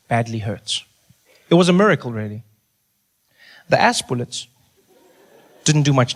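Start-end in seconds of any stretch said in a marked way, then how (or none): none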